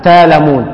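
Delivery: تألمون read as تالمون with a long a; hamza changed to alif. Shown as a demonstration. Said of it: "الألف".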